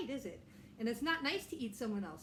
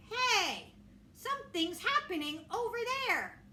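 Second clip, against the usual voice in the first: high, loud little cub voice; a small excited bear calling out; Baby Bear; 10 semitones higher, 5 dB louder than usual. Acted.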